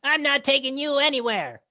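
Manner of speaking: high, loud little creature voice; defiant kobold shout. Acted. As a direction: yell a stubborn refusal at your captor. Kobold refuses its captor.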